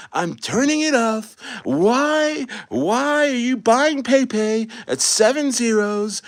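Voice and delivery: hammed-up sore-loser whine; mock whiny sore loser. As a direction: whine like a kid who quits the game. Voicing a whiny Trump in Madden.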